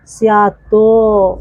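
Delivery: स्यातो said slowly, with the final तो fully sounded; full final o. Shown as a demonstration. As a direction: stretch स्यातो so the तो rings out completely. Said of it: "तो को पूरा बोलना है".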